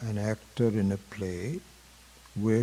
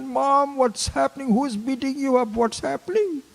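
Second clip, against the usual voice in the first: put-on high, loud frantic little girl's cry; panicked child; 6 dB louder than usual. Three-year-old begging her mom onstage, high and panicked. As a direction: cry out in alarm as a scared small child.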